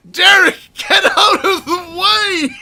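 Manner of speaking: very high, drawn-out yell breaking into laughter; hysterical shout. Real, not acted. Player yelling at Derek blocking the way, hysterical. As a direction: yell at someone in your way while losing it.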